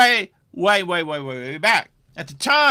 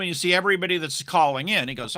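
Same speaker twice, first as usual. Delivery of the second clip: ordinary delivery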